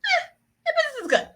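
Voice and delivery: very high, unconvincing squeak; mock unenthused brunch friend. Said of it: "you don't wanna be that person".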